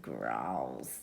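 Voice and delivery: low growl. Acted. Growl narrated, voice drops.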